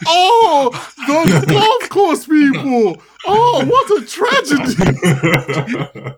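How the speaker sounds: high, slow, loud sarcastic wail through laughter; mocking laughing quote. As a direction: ham up fake sympathy while cracking up.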